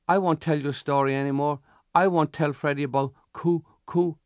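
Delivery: put-on high young boy's voice; little boy's promise. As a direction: promise like a chastened little brother. Frightened little brother promises, hugging.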